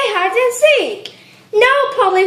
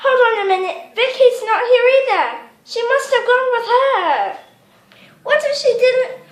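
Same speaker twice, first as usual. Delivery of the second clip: usual speaking voice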